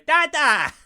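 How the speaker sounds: put-on high, loud child's call; imitated small daughter. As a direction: yell out like a little kid calling a parent. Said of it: "Arya just going".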